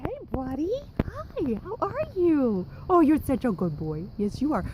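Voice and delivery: high doggy voice, praising the pup; talking to the dog. Real, not acted. Greeting her dog in yard.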